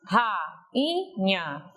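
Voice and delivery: "Rainha" split into ra-i-nha, with pauses; syllable by syllable. Shown as a demonstration. Teacher showing the syllables, word split syllable by syllable. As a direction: say "Rainha" one syllable at a time. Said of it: "Veja as sílabas".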